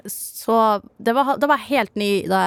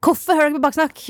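higher, louder playful reproach; louder mock scolding; 7 semitones higher, 4 dB louder than usual. To Sara's listening parents, hammed-up scolding. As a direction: scold them with exaggerated outrage. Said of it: "hvis dere hører på det her".

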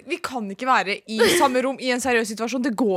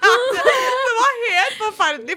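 high, slow laugh-cry; laughing despair; 11 semitones higher, 4 dB louder, much slower than usual. Real, not acted. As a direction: wail it through laughter. Laughing recalling awkward massage.

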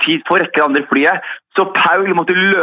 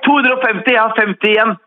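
indignant correction; 7 semitones higher than usual. Real, not acted.

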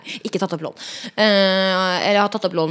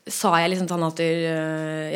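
ordinary talk

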